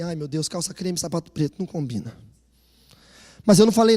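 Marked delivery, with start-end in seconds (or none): 0.0-2.2 soft thinking aloud, under the breath; a quiet private grumble to himself; inner thought. Retold or imitated. Husband silently judging wife's outfit, soft inner voice. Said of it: "eu pensei".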